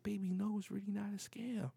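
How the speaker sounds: acted-out placating wife; pleading wife. Voices wife soothing him in quarrel.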